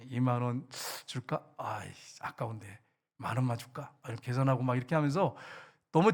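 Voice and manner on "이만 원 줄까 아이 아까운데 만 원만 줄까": lightly voiced miser, low and softer, weighing the money; mock grudging mutter to oneself; a hint of a tight-fisted giver haggling with himself; stingy inner calculation. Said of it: "계산을 해, 머릿속에".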